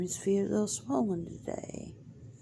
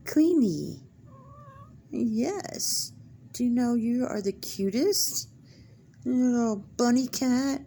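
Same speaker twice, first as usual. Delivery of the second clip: higher, cooing sing-song to a cat; pet baby talk; 4 semitones higher than usual. Acted. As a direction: coo over the cat like it's a baby. Owner coos to her cat.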